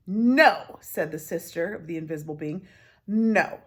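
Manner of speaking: loud, flat refusal; firm no. Acted. The being's sister firmly rejecting the lie. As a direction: shut them down.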